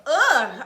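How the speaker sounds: loud delighted ugh; swooning groan. Real, not acted. Vlogger reacting to the sweet moment, delighted swoon. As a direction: melt with a happy groan.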